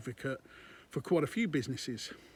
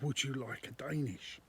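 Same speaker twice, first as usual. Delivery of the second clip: soft, gentle barista offer; soft lovely voice; 2 semitones lower, 6 dB quieter than usual. Retold or imitated. Barista upselling a pastry to a customer, soft and lovely. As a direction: coax the customer softly, like a kind barista. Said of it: "it was very soft and it was a lovely voice".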